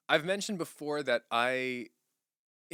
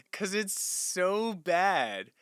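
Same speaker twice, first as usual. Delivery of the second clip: so drawn out long, voice rising high and falling; stretched so; 8 semitones higher, much slower than usual. Real, not acted. Laughing at his own bad accent, drawing out so. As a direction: lean hard on so, slowly, amused.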